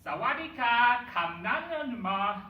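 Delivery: high, loud mock Thai phrase; sing-song put-on Thai; Thai accent. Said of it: "he'll speak, like, Thai".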